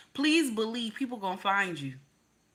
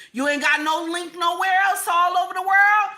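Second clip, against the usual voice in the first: higher and louder, driving the point; fired-up rant; 8 semitones higher, 6 dB louder than usual. Real, not acted. Telling native-born viewers they have nowhere, louder and higher. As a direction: push it up and out.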